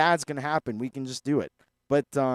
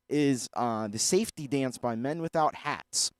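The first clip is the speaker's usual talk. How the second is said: ordinary talk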